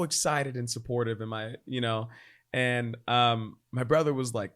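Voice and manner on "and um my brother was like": ordinary talk, nothing marked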